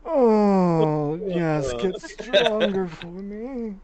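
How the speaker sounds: high, slow, hammed-up moan; mock moaning. Friend teasing the player with a hammed-up high moan. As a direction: moan it out like a lover.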